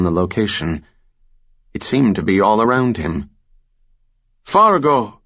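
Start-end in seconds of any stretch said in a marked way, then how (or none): 4.4-5.2 higher, louder call across the hillside; hollering. A narrator's reading. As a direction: holler the name from afar. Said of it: "Rivera hollered".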